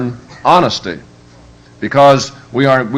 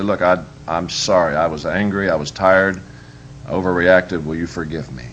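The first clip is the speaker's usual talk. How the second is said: lower, softer, gentle sorry; tender apology; 5 semitones lower, 5 dB quieter than usual. Retold or imitated. Father apologizing to his crying kid, low and soft. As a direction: soften and bring it down for a crying child.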